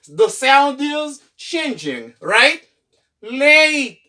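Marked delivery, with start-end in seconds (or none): 3.2-4.0 "Late" high and loud, A said as "ay"; long A. Shown as a demonstration. Teacher shows the sound changing, long A. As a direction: model "Late" with a falling "ay" glide. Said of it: "long sound of A".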